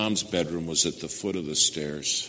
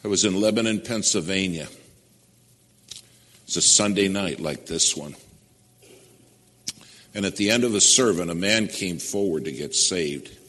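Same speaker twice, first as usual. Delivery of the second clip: louder, rising preacher's build, rushing the end of the sermon; preaching loud; 7 dB louder than usual. Real, not acted. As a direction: drive the story forward with full force.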